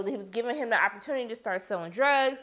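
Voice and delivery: ordinary talk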